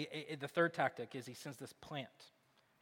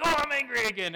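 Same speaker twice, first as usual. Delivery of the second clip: loud, high mock wail; imitating an angry little kid; toddler tantrum; 8 semitones higher, 7 dB louder than usual. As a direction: throw a pretend toddler fit. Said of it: "they're, like, falling on the floor".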